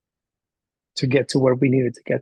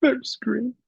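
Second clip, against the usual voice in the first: high-pitched, mock-crying words; imitated tearful child; 10 semitones higher than usual. Boy showing teacher his last color, high and tearful. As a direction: play a kid starting to cry mid-point. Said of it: "And he starts crying".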